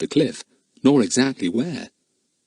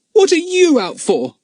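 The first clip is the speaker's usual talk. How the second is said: put-on defiant boy voice; 6 dB louder than usual. Boy demands an answer.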